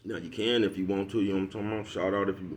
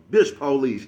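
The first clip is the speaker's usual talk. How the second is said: louder, higher put-down; mocking jab; 5 semitones higher, 8 dB louder, slower than usual. Calling the dudes bitch police, loud and higher. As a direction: spit out the label loud.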